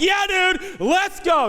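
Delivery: loud, high, acted enthusiasm; rally a little kid to go play; hyped-up agreement.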